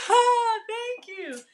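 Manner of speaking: high, slow drawn-out thank you; stretched sugary ah; sweet high thanks. Real, not acted. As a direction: coo a slow grateful thanks.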